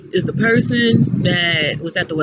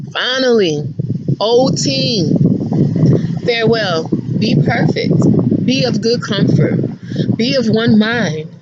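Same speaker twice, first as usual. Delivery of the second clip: scripture reading; 3 semitones higher than usual. Acted. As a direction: bless them off with pointed solemnity. Reads scripture to old team.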